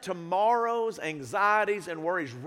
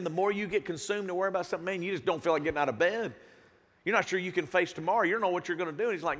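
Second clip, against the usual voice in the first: ordinary talk, nothing marked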